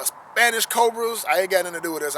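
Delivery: ordinary delivery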